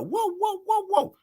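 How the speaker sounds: high-pitched wailing siren imitation; mimicked siren whoops; imitated siren. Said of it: "they have a siren on their car".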